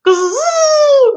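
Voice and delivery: imitated kazoo buzz, high and loud; kazoo noise. Son's kazoo interrupting the game, imitated buzz. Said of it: "you just hear this, like".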